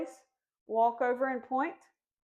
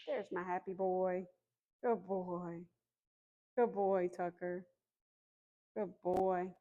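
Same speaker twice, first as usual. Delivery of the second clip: soft praise; 3 semitones lower, 5 dB quieter, slower than usual. Real, not acted. Praising puppy after recall.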